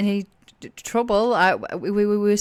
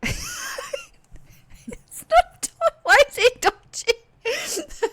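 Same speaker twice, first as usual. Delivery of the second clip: much higher, squeaky through laughter; laughing protest; much slower than usual. Real, not acted. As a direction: ask it while cracking up. Player laughing breaks character.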